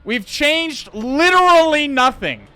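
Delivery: high, loud and slow, leaning hard on "literally"; exasperated outburst. Real, not acted. Streamer insisting to chat that nothing changed, loud and slow. Streamer's lab breaks for no reason.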